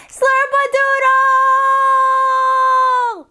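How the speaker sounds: long, high, drawn-out yell; full-volume shout, last syllable stretched way out; outside-voice shout. Shown as a demonstration. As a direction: holler it as loud as you can. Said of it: "as loud as you can".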